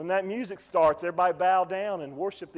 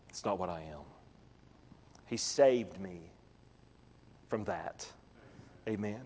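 hushed testimony; 13 semitones lower, 11 dB quieter than usual. Real, not acted. Preacher quietly testifying to congregation, hushed. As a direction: let it fall almost to a hush.

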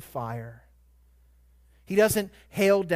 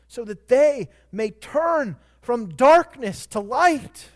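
higher, louder and slow, building to the peak of the sermon; preaching at full force; 6 semitones higher, 6 dB louder than usual. Acted. Preacher proclaiming Jesus' commission to the congregation, preaching at full force. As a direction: lean in and preach it, slowing on each phrase.

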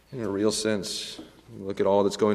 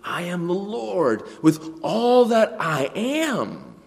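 God's solemn pledge; 5 semitones higher, 3 dB louder, slower than usual. Acted. God making his vow, solemn and emphatic. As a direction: swear it with your whole being. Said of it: "that's what God is doing".

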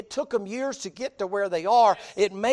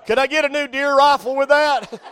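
hammed-up pleading, higher and louder; mock greedy husband; 4 semitones higher, 7 dB louder than usual. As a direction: beg for his own presents at the baby shower. Mock man asking for shower gifts.

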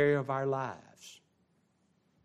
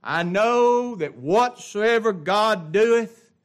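slow solemn quote; 9 semitones higher, 8 dB louder, much slower than usual. Acted.

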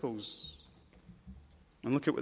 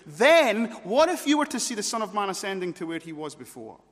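loud, high, punched opening word; forceful challenge; 6 semitones higher, 8 dB louder than usual. Acted. Jesus refusing to tone down his claims, forceful challenge. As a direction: declaim it at full preaching force.

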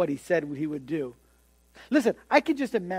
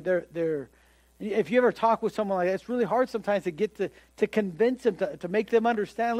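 normal talking voice

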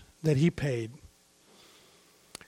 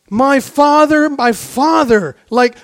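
1 anguished cry; 6 semitones higher, 6 dB louder than usual. Retold or imitated. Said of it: "He cried in anguish".

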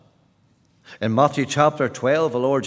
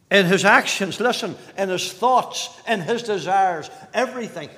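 emphatic pulpit declarations, building louder; impassioned preaching; 7 semitones higher, 7 dB louder than usual. Real, not acted. Preacher declaring that Christ never sinned, impassioned. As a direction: hammer each point home.

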